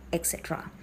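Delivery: ordinary talk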